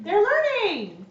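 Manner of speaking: drawn-out gleeful cry; excited echo. Acted. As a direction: stretch it out, delighted and high. Echoes friend's remark with glee.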